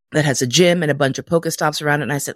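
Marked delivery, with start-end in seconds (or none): none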